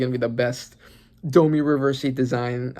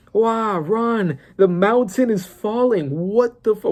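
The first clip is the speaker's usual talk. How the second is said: mock warning; 8 semitones higher, slower than usual. Mock-shouting at the characters.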